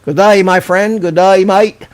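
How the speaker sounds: hammed-up Aussie "good day, mate"; mock Australian accent. Greeting Aussie viewer Yowie on the stream, mock Australian accent. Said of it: "I don't know if that's a great accent or not".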